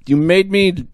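high, loud character voice; mock-outraged mouse. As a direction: squawk back in hammed-up protest. Mouse argues with robot in game.

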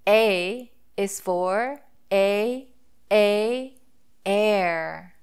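slow, "air" with a long a; sounding out A. Shown as a demonstration. Teacher modelling the long a in "air", slow. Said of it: "the sounds that the vowel letter A makes".

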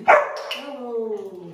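a loud burst of praise, then falling; excited praise. Real, not acted. Trainer cheering the dog for sitting, loud excited praise. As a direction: cheer the dog on for getting it right.

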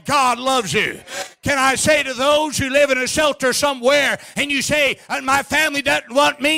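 high, forceful revival sermon delivery; fervent preaching. Real, not acted. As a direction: drive it home to the congregation, drawing out key words.

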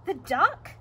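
loud, high, rising question; storybook thief. Acted. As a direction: ask it as a puzzled burglar. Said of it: "said Hugh".

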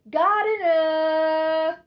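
long, loud, high-pitched holler; calling out. Acted. Fanny calling for the gardener, a loud drawn-out shout. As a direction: draw the word out in a full-voiced yell. Fanny calls out at monologue's end.

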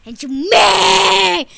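very long wailed mock fury; tantrum. Throwing a fit before onlookers, endless wailing tantrum. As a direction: hold the vowel like a bleating cry.

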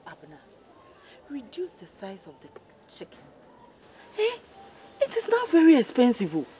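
ordinary talk, nothing marked

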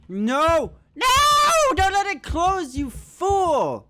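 high, loud cry of alarm; "no!" leaping up into a scream; panicked shout. Real, not acted. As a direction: yell at yourself as the door closes. Door closing on him in game.

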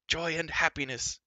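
high, loud gleeful cry; joyful cry. Acted. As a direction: burst out in joy. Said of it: "cried Gurgi".

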